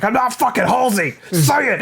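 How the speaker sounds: high, loud mock shouting at a stranger; mock furious yell. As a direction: scream it like a cornered man, hammed-up.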